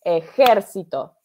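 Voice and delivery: ejército said slowly with JER punched, eJERcito; stress on JER. Shown as a demonstration. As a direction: hit the second syllable of ejército, e-JER-ci-to. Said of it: "en la sílaba tónica".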